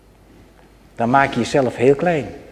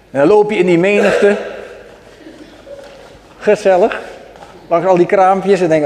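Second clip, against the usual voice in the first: ordinary talk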